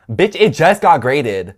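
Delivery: loud, slow outraged snap. Real, not acted. Vlogger ranting about the professor's late grading, loud and indignant. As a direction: let the indignation burst out.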